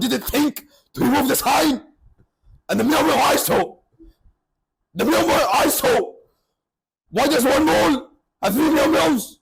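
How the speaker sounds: high, loud comic outrage; exasperated rant. Retold or imitated. Storyteller ranting about three identical stores, exasperated.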